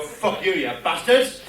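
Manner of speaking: ordinary delivery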